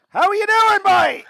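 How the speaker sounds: high, loud Westmeath countryman greeting; an old Irish emigrant's cheery Westmeath hello; Westmeath country accent. Retold or imitated. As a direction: greet him like a delighted Westmeath man out in the bush. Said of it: "country accent, Westmeath".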